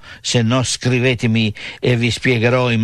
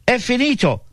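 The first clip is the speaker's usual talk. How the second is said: mock dying cry; 11 semitones higher than usual. Preacher retelling Jesus' last words on the cross, restrained high cry. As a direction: imitate a last cry, pitched high without shouting. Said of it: "gridò dopo aver sofferto".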